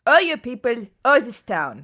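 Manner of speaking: loud, high protest; heated insistence. Acted. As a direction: insist at full volume to the interrogator. Prisoner argues back at interrogator.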